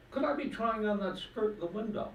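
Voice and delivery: put-on high, coy old lady voice; woman's voice. Joke teller voicing the woman asking for the skirt, high. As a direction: pitch up for the lady.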